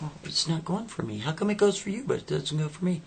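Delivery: high, whiny complaint; frustrated girlfriend. Acted.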